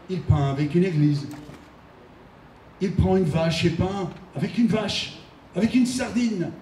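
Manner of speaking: dramatic poem recitation. Acted. Reads Cendrars's poem aloud.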